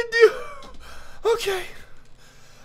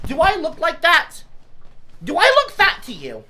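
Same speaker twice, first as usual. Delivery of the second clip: indignant; 7 semitones higher, 5 dB louder than usual. Real, not acted. Angry at fat-shaming comment.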